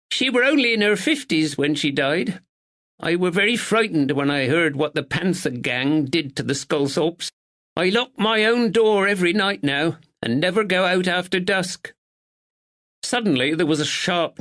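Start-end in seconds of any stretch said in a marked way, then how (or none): 0.1-11.9 higher, prim village woman; a proper village neighbour, a touch anxious; spinster neighbour. A narrator's reading. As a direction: speak as a dutiful northern spinster. Miss Bennet voiced.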